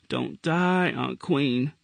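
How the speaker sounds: a mild moan over his aunt; understated tearful plea. A narrator's reading. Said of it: "he moaned".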